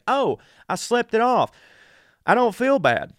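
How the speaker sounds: high and loud, falsely chipper; mock cheerful relief. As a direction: sound sure you've beaten the flu. Sick self fooled into wellness.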